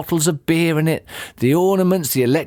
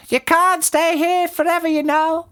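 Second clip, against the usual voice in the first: high-pitched bird character; roly-poly bird. A narrator's reading. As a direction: warn in a very high creature voice. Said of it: "the roly-poly bird said".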